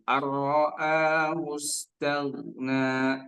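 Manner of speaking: slow Ar-ra'ahu stagna, gha unbounced; model verse. Shown as a demonstration. Teacher gives verse seven to repeat. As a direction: recite it slow and even. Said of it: "Baik, ulangi".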